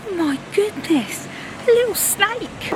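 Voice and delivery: sudden high exclaim of surprise; startled. Real, not acted. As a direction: jump at the sight of a snake. Spots a snake on the walk.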